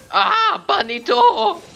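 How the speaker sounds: very high, loud scream. Real, not acted. Player in the thick of a fight, screaming. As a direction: scream it out at the top of the range. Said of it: "Sorry for my screaming".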